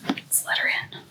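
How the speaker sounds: hushed whisper; late-night whisper at the door. Real, not acted. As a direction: whisper so as not to wake anyone. Letting cat in late night.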